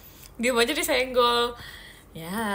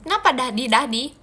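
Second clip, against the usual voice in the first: normal talking voice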